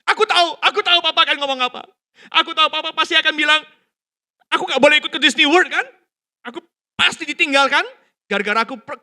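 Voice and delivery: loud, high, fast whining outburst; angry tantrum. Retold or imitated. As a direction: throw it back at dad like a furious child. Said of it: "si anak itu marah balik".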